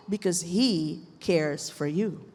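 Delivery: low, slow, gently spoken assurance; reassuring. Real, not acted. Preacher assuring listeners He cares for them, reassuring. Answers own why with scripture.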